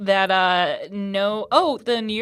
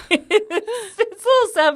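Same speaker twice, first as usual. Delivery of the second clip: laughing; 9 semitones higher than usual. Real, not acted. Laughing while admitting story is sad.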